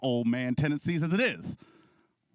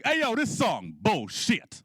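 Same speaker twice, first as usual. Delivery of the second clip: mild, higher-pitched indignant outburst; pissed-off old man; 8 semitones higher, slower than usual. Retold or imitated. Old man at the bar griping about a song, mildly pissed off. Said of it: "he gets really pissed off".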